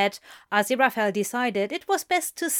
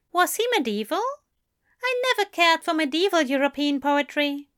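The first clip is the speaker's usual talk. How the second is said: higher, prim and puzzled; fussy angel; 7 semitones higher, faster than usual. A narrator's reading. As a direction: sniff at it primly. Angel fussy about poetry.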